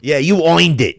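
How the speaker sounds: put-on Brooklyn accent. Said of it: "making fun of him saying".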